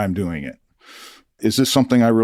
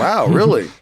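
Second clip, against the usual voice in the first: high, louder amazed wow; 9 semitones higher, 5 dB louder than usual. Retold or imitated. Told about the yodeling king, amazed. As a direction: burst out high and impressed. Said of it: "And I'm like".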